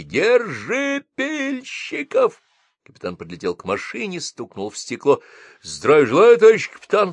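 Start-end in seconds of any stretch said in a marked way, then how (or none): 0.0-2.4 high, slow, far-off yell; a cry carried from the chasing crowd; crowd shouting. Acted. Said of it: "доносились крики". 5.6-7.1 loud, high military greeting; exclaimed greeting. Acted. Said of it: "вскричал шофёр".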